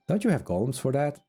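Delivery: normal talking voice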